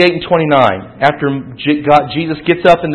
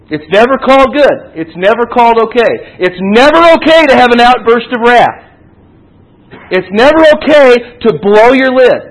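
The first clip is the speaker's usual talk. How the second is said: raised, higher voice driving the point home; louder and higher, insisting on each repeated "never"; emphatic preaching; 6 semitones higher, 5 dB louder than usual. Real, not acted. Preacher warning the congregation against outbursts of wrath, emphatic.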